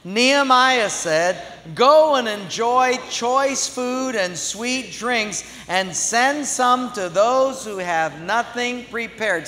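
slow, loud, theatrical reading; hammy stage actor. Shown as a demonstration. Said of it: "read it with our best thespian voices".

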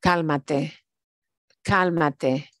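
Cálmate said clearly with the stress on CÁL; slow, careful Cálmate; model pronunciation. Shown as a demonstration. Teacher models the word for students, stress on CÁL. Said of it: "Cálmate, cálmate is calm down".

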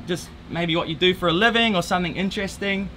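normal talking voice

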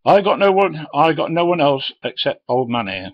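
high, loud pleading protest; flustered boss. Retold or imitated. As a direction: protest in a panic, short of men.

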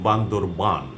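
বান্দরবান spoken clearly, final আন fully open; correct pronunciation. Shown as a demonstration. Teacher repeats বান্দরবান for news readers, deliberately. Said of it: "আমরা বান্দরবন বলবো না".

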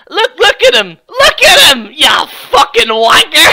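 hooting taunt; mocking yell. Real, not acted. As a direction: jeer at him on the screen. Mocking Polston on screen, yelling.

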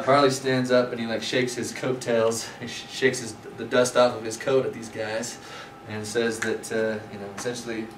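ordinary delivery

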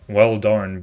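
ordinary delivery

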